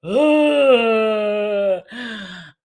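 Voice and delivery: sharp, loud gasp of delight. Real, not acted. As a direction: gasp as the card is revealed. Delighted gasp at drawn card.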